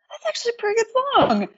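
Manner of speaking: high, fast, pleasantly surprised; suddenly impressed by a street busker; surprised realization. Retold or imitated. Hearing a busker's acoustic cover, high surprised delight.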